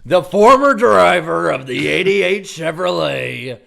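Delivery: very loud, slow booth announcer. Pretend. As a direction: introduce him like a TV broadcast. Mock broadcast booth intro.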